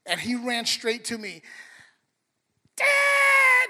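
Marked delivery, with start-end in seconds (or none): 2.8-3.7 put-on high, loud excited toddler shout of "Dad"; child calling out. Pastor voicing his toddler son running to him, high excited shout. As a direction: yell it like a thrilled little boy running in.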